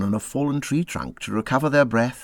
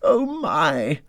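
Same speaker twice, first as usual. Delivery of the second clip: miserable moaning; 6 semitones higher, much slower than usual. A narrator's reading. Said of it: "said the mole miserably".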